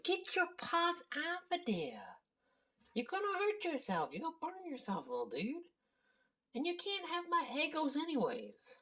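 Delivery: high cooing voice scolding the cat; high pet-talk voice. Real, not acted. Owner shooing her cat off breakfast, high pet voice.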